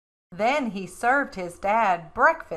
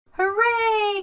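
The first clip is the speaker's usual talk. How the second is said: much higher cheer; excited cheer; 12 semitones higher than usual. A narrator's reading. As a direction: squeal with joy. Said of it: "cried Froggy".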